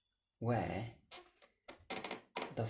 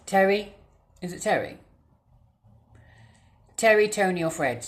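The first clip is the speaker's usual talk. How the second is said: calling out; 4 semitones higher, 3 dB louder than usual. Real, not acted. Calling out to the ghost.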